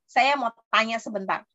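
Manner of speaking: ordinary talk, nothing marked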